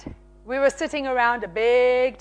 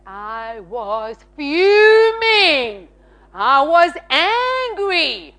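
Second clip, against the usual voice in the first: drawn-out, swelling indignation; hammed-up slow-burning anger; dramatized fuming; much slower than usual. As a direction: stretch FUMING and ANGRY out slowly. Dramatizes her past fury.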